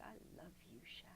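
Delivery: soft whispered I love you through tears; tender whisper. Retold or imitated. Mother-in-law whispering love to her over okra, soft and tender. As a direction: breathe it gently, full of love.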